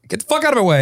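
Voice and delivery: rude New Yorker. Retold or imitated. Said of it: "A New Yorker would be like".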